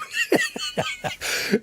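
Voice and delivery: very high helpless giggle; squeaky laugh. Real, not acted. Streamer laughing because Curly won't help.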